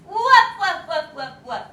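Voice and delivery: playful woofing; quick light woofs; dog barks. Shown as a demonstration. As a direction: bark like a puppy. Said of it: "lots of dogs".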